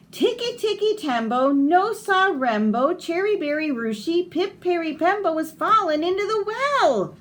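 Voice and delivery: put-on high, eager child voice calling his mother; little Chang calling. Small boy calls to his mother at the well, high and eager. Chang repeats name, breathless.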